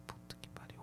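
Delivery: soft whispered despair; whispered curse. Retold or imitated. Traveler told he must stay, whispered curse. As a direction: breathe it out, beaten.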